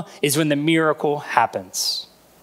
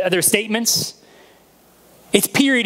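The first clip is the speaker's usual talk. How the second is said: normal talking voice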